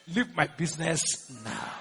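slow, low, softened order; measured command. Shown as a demonstration. Preacher ordering stagnation out of business, slow low command. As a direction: sink low and soft, word by word.

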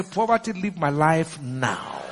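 slow, low, deliberate banishment; measured command. Shown as a demonstration. Preacher showing how to address poverty, slow low command. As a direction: draw it out low, word by word. Same command, slowed and lowered.

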